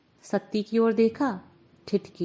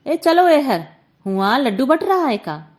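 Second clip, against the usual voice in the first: scolding; 3 semitones higher, 7 dB louder than usual. A narrator's reading. Aunt scolding the children trailing behind, loud. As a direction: shoo the kids away loudly. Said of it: "बुआ ने डांटा".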